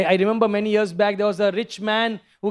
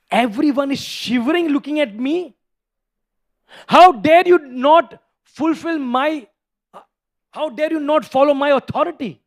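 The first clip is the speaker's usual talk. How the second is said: angry king; 4 dB louder than usual. Retold or imitated. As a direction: roar at the defiant son. Hiranyakashipu was extremely angry.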